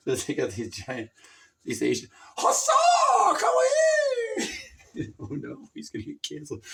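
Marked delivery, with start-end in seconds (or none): 2.4-4.5 high, loud put-on shout; caricature shout. Calling someone over in a caricature voice, high. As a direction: bark it in a mocking voice.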